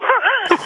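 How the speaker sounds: quick high burst of laughter; real amused laugh. Real, not acted. Laugh at anecdote.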